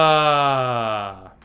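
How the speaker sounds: long, loud open sigh on ah; relaxed sigh. Shown as a demonstration. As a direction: let the breath fall out freely on a sigh. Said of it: "let's just try that a few times".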